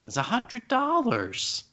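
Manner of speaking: high, drawn-out disbelief; incredulous. Real, not acted. Parent balking at an expensive Lego set, incredulous. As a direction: balk at the price in a high, stretched voice. Complaining about the Lego's price.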